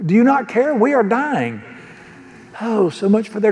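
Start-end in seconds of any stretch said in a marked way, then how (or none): 0.1-1.6 a hint of frightened sailors crying out; mock panic. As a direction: play the scared disciples, a bit higher. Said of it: "they said to him".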